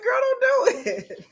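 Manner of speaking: high, slow don't-do-it through laughter; yelling at the horror movie heroine; pleading at the screen, cracking up; laughing warning. Retold or imitated. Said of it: "I'm like".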